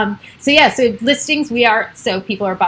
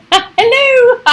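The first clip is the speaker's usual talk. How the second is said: high, loud goofy hello; silly put-on voice; 13 semitones higher, 7 dB louder than usual. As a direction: sing out a hello in a daft squeal. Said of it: "I don't know why I do that voice".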